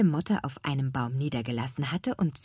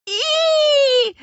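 very high, loud squeal; disgusted shriek; 7 dB louder than usual. A narrator's reading. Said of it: "hörte man Lea aus der Ferne kreischen". (schrilles, angeekeltes Kreischen)

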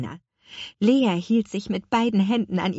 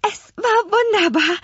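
very high, breathless delight; gushing; 13 semitones higher, 4 dB louder than usual. A narrator's reading. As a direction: gush with rapture, voice soaring. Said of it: "schwärmte Lea". (begeistert geschwärmt)

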